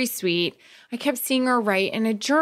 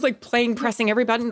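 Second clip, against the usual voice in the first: ordinary delivery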